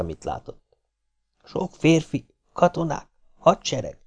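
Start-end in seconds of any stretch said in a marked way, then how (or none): 1.4-4.0 halting broken-English report, word by word; foreigner's broken English, pitched up. A narrator's reading. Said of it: "a maga tört angolságával elmagyarázta".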